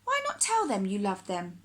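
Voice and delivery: plain reading voice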